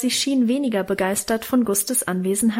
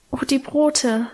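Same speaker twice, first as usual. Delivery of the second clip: sighing; 3 semitones higher than usual. A narrator's reading. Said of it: "seufzte Minchen".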